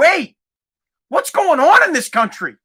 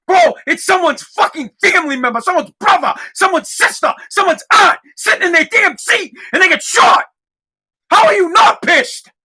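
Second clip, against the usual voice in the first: high, loud impassioned shouting; real outrage; 6 semitones higher, 3 dB louder than usual. Real, not acted. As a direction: build to an incredulous angry yell. Outraged rant at critics.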